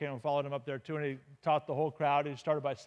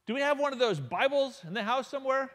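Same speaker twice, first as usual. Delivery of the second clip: yelling to maid; 9 semitones higher, 4 dB louder than usual. Retold or imitated. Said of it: "he yells to his maid".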